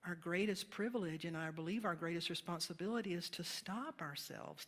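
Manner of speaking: normal talking voice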